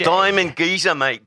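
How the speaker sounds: put-on Cockney accent. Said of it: "I wanna hear your Cockney accent".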